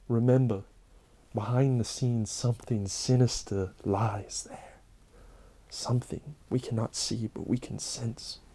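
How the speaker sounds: soft, eerie whispered warning; spooky whisper. Acted. Closing a Halloween story for viewers, eerie whisper. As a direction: lean in and whisper it like a ghost story.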